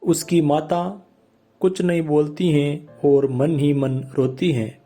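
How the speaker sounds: usual speaking voice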